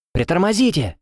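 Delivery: high urgent shout. A narrator's reading. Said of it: "закричала я".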